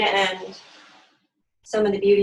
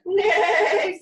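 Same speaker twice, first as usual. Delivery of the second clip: high, loud neighing nay; whinny the word like a horse; 12 semitones higher, 5 dB louder than usual. Acted. Horse answering Duck, high loud neigh. Horse's animal noise in story.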